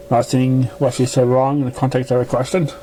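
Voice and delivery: usual speaking voice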